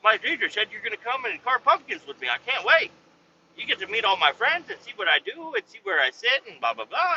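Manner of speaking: put-on high, eager kid voice; put-on child's voice, bubbling with excitement; excited young son. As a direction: gush like a child who can't wait. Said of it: "And my son's like".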